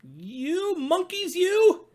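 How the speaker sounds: high, loud scolding, stretched out; scolding peddler. A narrator's reading. Peddler speaking up to the monkeys in the tree, loud high scolding. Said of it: "he spoke to them".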